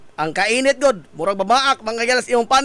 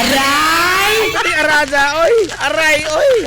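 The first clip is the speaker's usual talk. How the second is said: hammed-up cries of pain, higher and louder; mock ouch; 4 semitones higher, 4 dB louder than usual. Radio host jokes about skin burning, mock ouch. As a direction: yelp as if the sun burns your skin.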